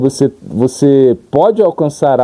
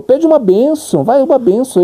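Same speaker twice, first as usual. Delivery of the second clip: Mahaprabhu urging; 13 semitones higher than usual. Acted. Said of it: "Mahaprabhu falou".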